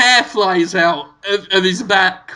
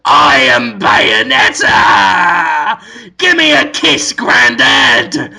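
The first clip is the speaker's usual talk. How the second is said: loud, slow, hammed-up Bayonetta bellow; over-the-top shouted Bayonetta; 2 semitones lower, 5 dB louder, much slower than usual. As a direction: yell it like a campy witch. Dafoe as Bayonetta, goes.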